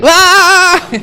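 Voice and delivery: long, very high, loud mock scream; horrified scream. Gopi threatened with becoming her husband, shrieking. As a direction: shriek it out in comic horror.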